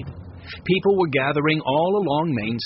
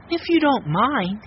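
put-on young girl voice; 9 semitones higher, 3 dB louder than usual. A narrator's reading. Violet voiced by reader.

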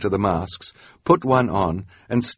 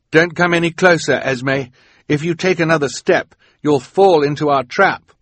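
high, loud, pressing warning; urgent warning; 9 semitones higher, 7 dB louder than usual. A narrator's reading. Quigley stopping Esme short of the trap, urgent warning. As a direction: warn her off firmly and quickly.

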